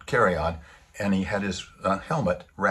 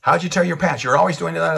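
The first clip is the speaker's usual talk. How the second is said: high, loud mock scolding; mother ripping into son; 8 semitones higher, 6 dB louder than usual. Receptionist laying into her son over torn pants, high and loud. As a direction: pitch it up and rip into the kid, hammed-up. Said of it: "and she rips into him".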